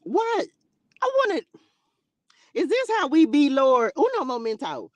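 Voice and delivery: high, loud, taken-aback outburst; mock disbelief. Preacher reacting to Cain killing his brother, mock disbelief. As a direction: squawk it in playful shock.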